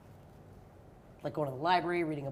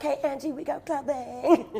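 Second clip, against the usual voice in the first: put-on Valley Girl; 4 semitones higher than usual. Shown as a demonstration. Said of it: "but in Valley Girl?".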